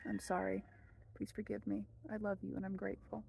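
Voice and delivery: soft, hushed prayer recitation; quiet prayer. Shown as a demonstration. Teacher reciting the prayer for viewers, hushed and soft. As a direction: murmur it like a private prayer.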